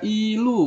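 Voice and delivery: "ilu" with the "i" held high and level; awkward first-tone reading. Shown as a demonstration. Teacher showing an awkward reading, "ilu" with high level "i". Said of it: "agak gimana ya bunyinya".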